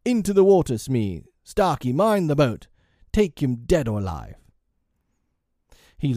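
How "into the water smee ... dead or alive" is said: loud, high pirate commands; captain rallying the crew at volume; shouted orders. A narrator's reading. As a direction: shout urgent orders to your men. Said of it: "Hook shouted".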